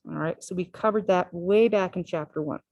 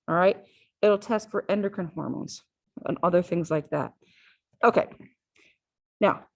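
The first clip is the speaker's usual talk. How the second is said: usual speaking voice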